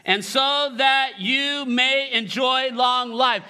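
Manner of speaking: slow, measured, word by word; leading group recitation. Real, not acted. Preacher having the congregation repeat the verse together, slow. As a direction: stretch each word for everyone to follow. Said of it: "Let's say that together".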